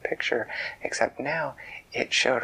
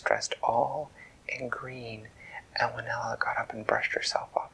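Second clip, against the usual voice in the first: usual speaking voice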